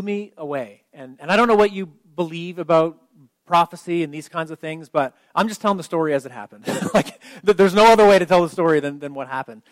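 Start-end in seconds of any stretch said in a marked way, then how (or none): 4.9-8.8 laughing. Real, not acted. Laughs at his own story.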